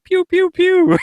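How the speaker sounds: high, loud pew-pew noises; cartoonish shooting noise; imitated laser fire. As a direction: fire off playful high-pitched blaster sounds. Imitates gunfire in email reading.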